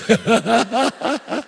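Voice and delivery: a short high burst of laughter. Real, not acted. Laughs after punchline.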